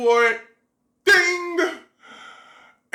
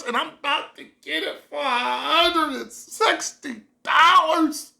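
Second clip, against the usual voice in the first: high, near-crying excitement; tearful joy; 6 semitones higher than usual. Retold or imitated. Comparing the price to America's, tearful overjoyed excitement. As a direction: squeal it out, almost weeping with joy.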